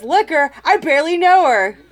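loud, high punchline delivery. Acted. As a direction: belt the punchline out. Punchline of her own joke.

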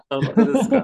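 high, laughing disbelief. Real, not acted. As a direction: ask it through giggles. Laughing at cohost's confession.

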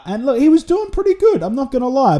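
high, bursting with enthusiasm; can't contain how impressed he is; excited. Real, not acted. Telling viewers about the quiz, excited.

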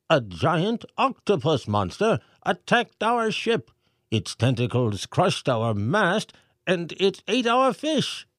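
high, pompous mayor voice reading aloud; mayor reading letter. A narrator's reading. Mayor reads the pelican's message to the crowd, pompous. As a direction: read the message like a fussy mayor.

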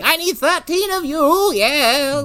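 high shrill crone voice; witch voice. Retold or imitated. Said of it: "She's like".

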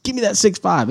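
high, urgent grab for the rifle; excited urgent call. Retold or imitated. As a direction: pitch it up, demanding the gun. Excited hunter calling for gun.

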